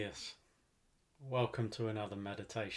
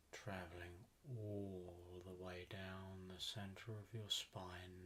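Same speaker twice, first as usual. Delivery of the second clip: guided meditation; 8 dB quieter than usual. Acted. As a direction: ease them down into relaxation, unhurried and even.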